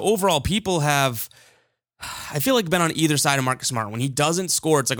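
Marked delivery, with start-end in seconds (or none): none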